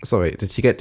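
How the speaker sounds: ordinary talk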